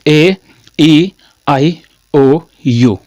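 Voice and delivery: A, E, I, O, U said one at a time, slowly; slow, separated "ए ई आई ओ यू"; vowel drill. Shown as a demonstration. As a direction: space out each vowel with a full mouth shape. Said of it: "और आपको क्या बोलना है".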